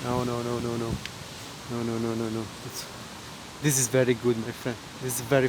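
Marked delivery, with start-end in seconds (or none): none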